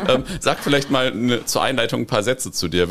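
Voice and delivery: a laugh running through the words; laughing while asking. Real, not acted. Host laughing along with his guest while asking her to introduce herself. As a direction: giggle out the request as you speak.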